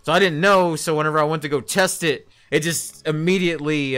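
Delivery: ordinary talk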